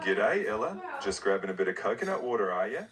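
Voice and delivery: put-on Aussie accent; Australian accent. Co-host greeting the host's daughter, Australian accent. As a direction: greet her in casual Australian. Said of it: "can you speak in her accent?".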